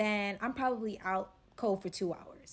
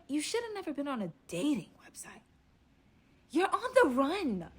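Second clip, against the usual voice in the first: cutting him off; 5 semitones higher than usual. Retold or imitated. She cuts the robber off.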